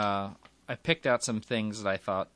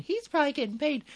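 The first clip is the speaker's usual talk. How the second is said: high-pitched, quick mock listener; suspicious listener; faster than usual. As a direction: voice the skeptic's thought up high and fast. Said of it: "You're probably thinking".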